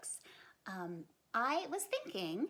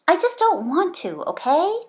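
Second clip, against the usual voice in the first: put-on higher, louder indignant kid voice; Bean protesting; 7 semitones higher, 19 dB louder than usual. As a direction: insist like a kid defending herself. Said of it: "said Bean".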